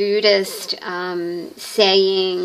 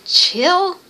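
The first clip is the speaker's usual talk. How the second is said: laid-back chill out; 8 semitones higher, 4 dB louder than usual. Acted. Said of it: "essentially he goes".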